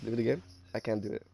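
strained, sore throat voice. Real, not acted. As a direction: speak through a sore throat. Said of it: "Sorry, I have a sore throat".

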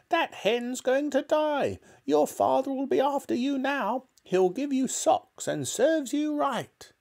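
scolding, piercing high granny screech; shouting. A narrator's reading. Grandma blaming George for the hen, shouting. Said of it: "Grandma shouted".

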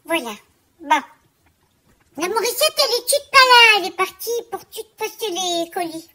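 a quirky small-business lady complaining to a friend; Germaine character voice. Acted. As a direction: play a fussy chatty seamstress. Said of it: "c'est toute Germaine".

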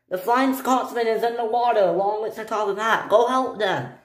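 high, anxious acted voice; urgent report. Stephen sending Harold to help, high urgent voice.

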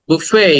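buffet as "boo-FEY", silent t, long ending; correct pronunciation. Shown as a demonstration. Teacher models it after the wrong version, careful pronunciation. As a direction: stretch the final "ey" of buffet. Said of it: "লাস্টে একটা এই sound আছে".

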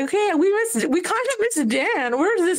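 playfully miss the fun driver; mock dismay.

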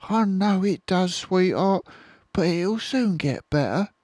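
high, soothing motherly voice; high tender fox mother. Acted. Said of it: "she said between lick".

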